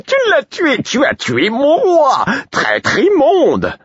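the jester's accusation climbing into a wail; shrieking fool. Acted. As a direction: drag out each word in grief and rage. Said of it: "me cria le fou".

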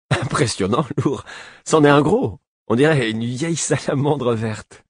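teenage prince mock-impressed, barely holding a laugh; amused teasing. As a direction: tease him with laughing praise.

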